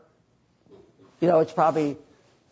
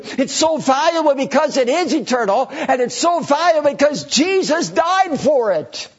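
higher, louder building preaching; full-force preaching; 8 semitones higher, 4 dB louder than usual. Real, not acted. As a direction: drive it home to the congregation.

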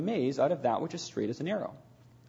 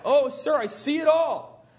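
high, excited outburst of sudden joy; joyful cry; 6 semitones higher than usual. A narrator's reading. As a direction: read it as a startled, delighted cry. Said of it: "She startled us all as she cried".

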